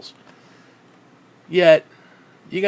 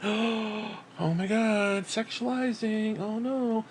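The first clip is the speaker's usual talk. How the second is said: higher, slow, softer mock pearl-clutcher; mock scandalized prude; 5 semitones higher, 7 dB quieter, much slower than usual. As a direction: drag it out like a shocked prude.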